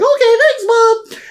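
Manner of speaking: put-on excited kid. The child thanking his mother, squeaky and excited. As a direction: squeak it like a happy little kid. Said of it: "And you're like".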